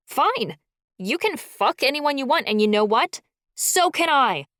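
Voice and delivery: high, loud furious scream; screaming back. A narrator's reading. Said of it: "I screamed back".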